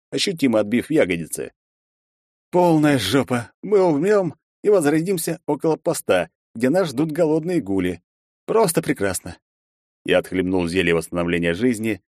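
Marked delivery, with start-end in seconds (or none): 2.5-9.4 exasperated. Acted. Герой ругается в отчаянии.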